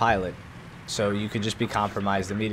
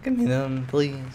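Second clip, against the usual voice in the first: drawn-out pleading; 7 semitones higher, much slower than usual. Pretend. Pleading for jets.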